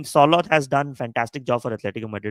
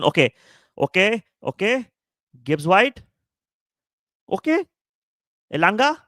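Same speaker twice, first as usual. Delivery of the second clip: excited; 8 semitones higher, 5 dB louder than usual. Real, not acted. Reacting live to match action.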